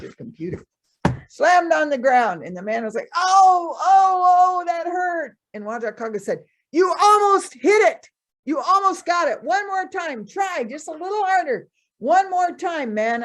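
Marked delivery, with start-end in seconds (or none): 3.1-5.4 high, loud pained yelps; man hurting, yelping in high pain. Acted. Man hurt after penis hits ground. 6.7-13.2 eager urging. Acted. Trickster urging man on.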